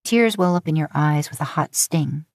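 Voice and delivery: ordinary talk, nothing marked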